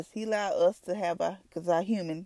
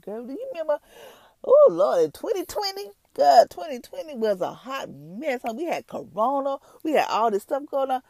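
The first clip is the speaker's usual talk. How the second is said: put-on much higher, loud "Oh, Lord" gushing to a girlfriend; excited reminiscing; 9 semitones higher, 6 dB louder than usual. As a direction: squeal it like old gossip about a crazy year. Future self gushing to friend.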